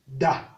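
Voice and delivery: Da on a short low tone. Shown as a demonstration. Teacher reading syllables, Da on low tone. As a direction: drop Da to the low tone. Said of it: "ton bas".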